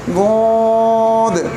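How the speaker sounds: गोद drawn out long and heavy; heavy lengthened letter. Shown as a demonstration. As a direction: stretch गोद with a thick sound. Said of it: "ये भी मोटा करना है और इसको लंबा भी करना है".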